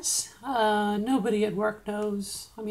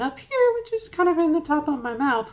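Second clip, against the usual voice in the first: much higher, pushed up into the top of the mouth; fake high head voice; 6 semitones higher, 5 dB louder than usual. Shown as a demonstration. Demonstrating to viewers the voice she avoids, much higher and louder. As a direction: show the fake-sounding high voice up front. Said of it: "which sounds really fake".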